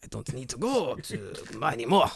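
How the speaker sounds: mock French accent on a self-important onsen lover; snooty French accent. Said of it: "my snooty, I spent one term in France, Japanese person".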